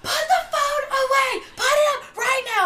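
high, loud mock shout; yelled at. [name] imitating friends shouting at her, high and loud. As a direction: squeal the order like a scolding crowd. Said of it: "then they're like".

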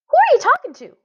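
put-on a curious little girl catching him; little sister's voice. A narrator's reading. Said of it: "Violet asked".

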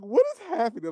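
high-pitched baffled exclamation; retold bewilderment. Retelling own bewildered reaction.

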